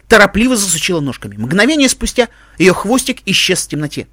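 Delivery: usual speaking voice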